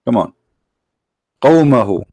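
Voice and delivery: ordinary talk, nothing marked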